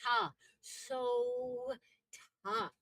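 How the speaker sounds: SO TA with both syllables drawn out; over-enunciated regional SO TA; elongated vowels. Shown as a demonstration. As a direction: split SO TA into two long syllables. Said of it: "we elongate the A's and the E's".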